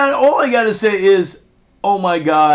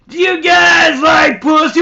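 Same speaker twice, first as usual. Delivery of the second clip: high, loud crude come-on; a loud mock proposition; skanky woman's voice; 8 semitones higher, 7 dB louder than usual. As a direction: ham up the woman's shouted offer.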